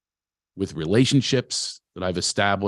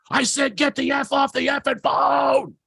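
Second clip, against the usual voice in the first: furious scream. Retold or imitated. Producer screaming down the phone at the actor, furious shout. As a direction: bellow it down the phone line. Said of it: "And finally he screams".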